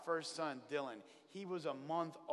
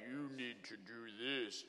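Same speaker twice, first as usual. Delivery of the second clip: talking cow; 6 semitones lower, 8 dB quieter, slower than usual. Retold or imitated. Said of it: "that cow staring back at me is just gonna be like".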